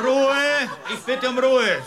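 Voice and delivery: high, loud call for order; shouting for silence. Acted. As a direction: bark it over the noise of the room. Judge silencing laughing courtroom.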